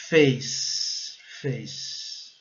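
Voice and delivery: FACE as "fayss", ending on a long S; silent final E. Shown as a demonstration. Teacher modelling face for learners, silent final E. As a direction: hold the S in FACE, no vowel after. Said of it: "o E nunca é pronunciado".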